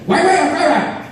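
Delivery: high-pitched mock takeout counter voice; restaurant worker. Worker asking a teriyaki customer about rice, high put-on voice. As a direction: push the order question out in a high caricature. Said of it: "when you go to them".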